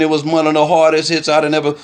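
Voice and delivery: ordinary delivery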